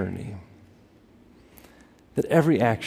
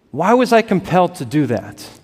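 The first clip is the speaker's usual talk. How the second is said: ordinary talk, nothing marked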